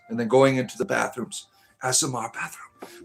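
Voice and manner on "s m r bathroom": breathy ASMR whisper; mock ASMR whisper into the mic. Shown as a demonstration. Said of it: "I joked around about doing an S M R".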